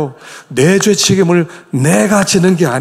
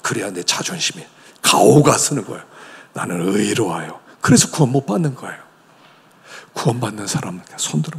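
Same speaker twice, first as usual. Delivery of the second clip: ordinary delivery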